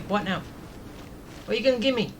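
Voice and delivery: ordinary delivery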